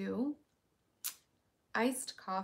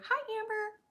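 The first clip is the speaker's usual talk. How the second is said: much higher, chirpy voice-assistant greeting; Alexa voice; 13 semitones higher, 4 dB louder than usual. Shown as a demonstration. Viewer's request answered in a high Alexa voice. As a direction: play the smart speaker greeting a viewer. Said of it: "Can you say".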